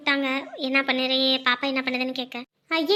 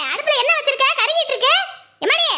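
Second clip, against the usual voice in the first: nagging mother-in-law; 9 semitones higher than usual. Acted. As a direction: scold her about the burning pot. Mother-in-law scolds about burning food.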